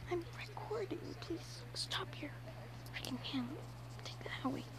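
hushed annoyed whisper. Real, not acted. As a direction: whisper it so the mic barely hears. Hides aside from recording.

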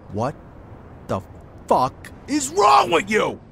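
furious shout. Acted. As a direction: bellow it out in disbelief and rage. Boss explodes at drunk Joe.

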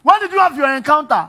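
high, very loud shouting; loud, worked-up confrontation; heated challenge. Acted. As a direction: fire the question back at full volume. Shouting match about encounter with God.

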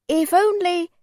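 high, wistful tone, left dangling; hesitant hint. A narrator's reading. Old man hints at a favour, hesitant. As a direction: float it hopefully and leave it unfinished.

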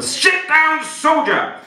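high, loud military order; Marine bellow. Shown as a demonstration. Hypnotist modelling a Marine's order to volunteers, bellowed. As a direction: bellow it like a drill command. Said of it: "as a member of the United States Marine Corps".

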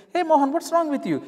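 high, startled call across the field; alarmed coworker. Acted. Fellow worker calling out to Mohan in the field, high and alarmed. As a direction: warn him he's cutting the wheat.

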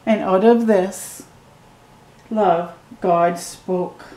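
ordinary delivery

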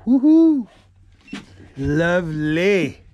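ordinary delivery

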